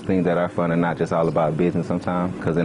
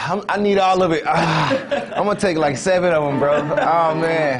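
excited; 10 semitones higher than usual. Real, not acted. Excited laughing before the flight.

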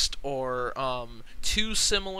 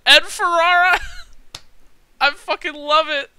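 much higher, louder shout into a squeal; excited shout; 11 semitones higher, 9 dB louder than usual. Real, not acted. Gamer spotting a favorite name, excited shout. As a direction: yell the name with delight.

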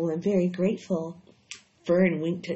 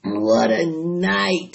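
slow hoarse groan, much louder; 6 dB louder, much slower than usual. A narrator's reading. Rat boasting to Wilbur about his feast, hoarse. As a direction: drag it out hoarsely, full to bursting. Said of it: "he repeated hoarsely".

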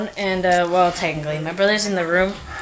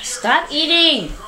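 drawn-out, higher exasperated scold; annoyed scolding; 6 semitones higher, much slower than usual. Real, not acted. Sister scolding her brother for eating, exasperated. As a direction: tell him off slowly, fed up.

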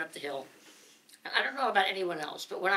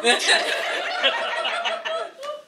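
very high loud laughter; high shrieking laugh; 7 dB louder than usual. Real, not acted. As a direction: squeal with laughter. Laughing hard.

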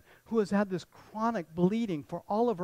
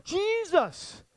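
high-pitched desperate call for attention; crying out; 11 semitones higher, 4 dB louder, much slower than usual. Acted. Blind man calling to Jesus over a crowd, crying out. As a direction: holler the name out, far higher than usual. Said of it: "Bartimaeus is crying out".

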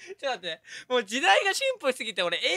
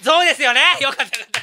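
big relieved exclamation; real excited relief; loud relief; 2 semitones higher, 11 dB louder, faster than usual. Real, not acted. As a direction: burst out loudly, vindicated. Relieved his guess was right.

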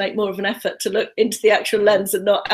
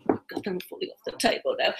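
muttered aside; 8 dB quieter than usual. Real, not acted. Muttered aside while filming.

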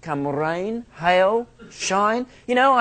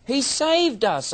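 higher, slow He saved us; repeated for emphasis; 7 semitones higher, slower than usual. Acted. Preacher repeating the verse for his hearers, slow and high. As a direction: stretch out He saved us. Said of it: "I say".

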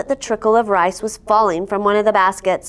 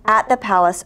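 plain reading voice

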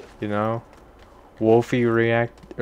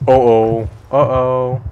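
loud uh-oh; 8 dB louder, slower than usual. Real, not acted. About to die in game.

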